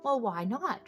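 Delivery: ordinary delivery, nothing marked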